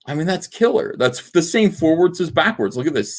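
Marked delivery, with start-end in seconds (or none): none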